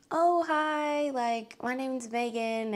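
high, slow and timid, a touch starstruck; shy fan hello. Retold or imitated. Fan nervously greeting the founder she admires, high and timid. Nervously introducing herself to her idol.

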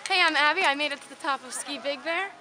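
ordinary talk

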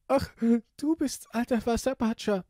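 very high, loud tearful voice; weeping princess. Acted. Princess spots the frog while crying, high tearful wail. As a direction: wail it like a little storybook princess.

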